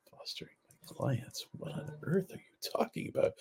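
high, very soft, breath-heavy incredulity; near-voiceless, bewildered retort; hushed disbelief. Retold or imitated. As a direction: whisper it in baffled disbelief. Retelling his baffled reply to mentor.

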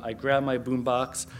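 usual speaking voice

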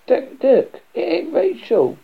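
high-pitched, frantic hysterics; acted hysterical wail. A narrator's reading. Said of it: "Dean in hysterics".

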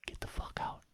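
voiceless, very soft command; hushed mock threat; whispered order. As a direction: whisper it as a retold warning. Retold order to the cat.

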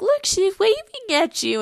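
put-on high, bright voice for a child; sing-song mom voice. Mother showing her daughter the waving baby, high sing-song. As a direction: coo it like a mother pointing to a screen. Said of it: "And I was like".

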